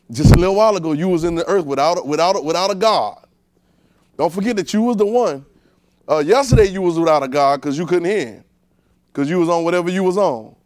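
loud, pounding sermon delivery; forceful preaching. Real, not acted. Preacher reminding believers of their godless past, forceful preaching. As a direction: preach it loud to humble them.

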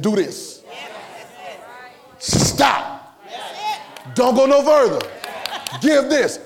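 emphatic divine orders, each one separate; direct command. Acted. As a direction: command it with no room for choice. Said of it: "Holy Spirit said".

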